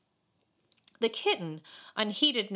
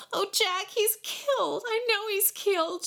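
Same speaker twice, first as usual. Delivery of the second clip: put-on high, mild wail of a tearful girl; 14 semitones higher than usual. A narrator's reading. Tearful girl wails to Jack about the fallen boy. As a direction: cry out to Jack in a light wail. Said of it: "she wailed".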